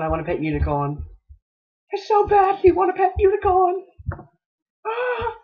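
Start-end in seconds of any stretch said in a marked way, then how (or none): 1.9-5.3 very high whiny pleading; high squeaky longing. Acted. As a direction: squeak it like a child begging. Longing for a pet unicorn.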